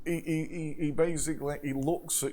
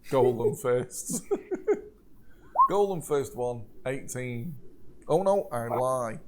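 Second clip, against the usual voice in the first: ordinary talk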